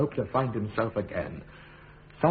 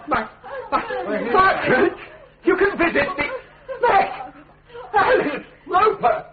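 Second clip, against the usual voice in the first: high, loud, laughing with joy; stammering with happy laughter at the news; overjoyed; 5 dB louder than usual. Acted. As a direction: burst out in delighted disbelief. Prisoner overjoyed family visits.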